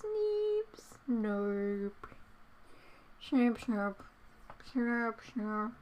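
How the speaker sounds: soft silly critter noises; funny noise. Pretend. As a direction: mumble like a sleepy critter. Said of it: "making me make funny noises".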